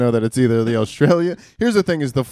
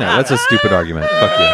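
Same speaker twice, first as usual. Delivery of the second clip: mock angry shout; 6 dB louder than usual. Mock argument, repeated.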